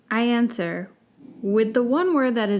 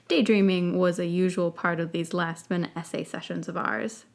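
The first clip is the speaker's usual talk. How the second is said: ordinary delivery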